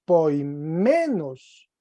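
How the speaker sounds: poimenos with the accent pushed onto "men"; stress on MEN. Shown as a demonstration. Teacher correcting a student's reading of the accent, stress on "men" in poimenos. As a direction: lean hard on "men" in poimenos. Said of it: "la lectura del acento es".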